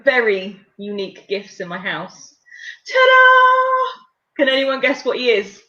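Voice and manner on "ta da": high, loud sing-song fanfare; triumphant reveal. Pretend. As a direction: present it like a magician's big reveal. Reveals her mystery object with fanfare.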